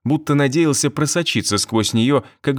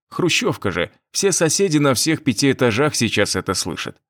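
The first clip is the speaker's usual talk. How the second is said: ordinary delivery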